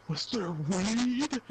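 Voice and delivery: slow, drawn-out question; slow suspicious mom. Retold or imitated. Mom slowly asking son about weed, suspicious. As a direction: draw it out like a mom who knows.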